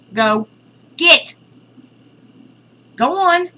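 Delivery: high, loud shoo; shooing shout. Real, not acted. Shooing the cat off the altar, rising loud shout. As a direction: chase the animal off with a yell.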